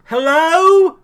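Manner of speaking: loud, high call into the dark; yelling hello. Retold or imitated. Mocking Jim calling into dark places, with a loud, high yelled hello. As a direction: call it out loud into an empty room. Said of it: "dark places and yelling".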